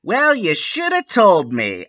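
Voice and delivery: high snippy retort. A narrator's reading. Ebony retorting to Lupin. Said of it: "I replayed".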